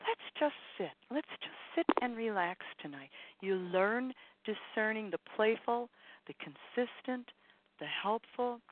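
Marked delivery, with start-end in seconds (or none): 0.1-3.1 higher, gentle inner voice; little voice. Acted. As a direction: coax softly as a small inner guide. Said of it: "A little voice will say".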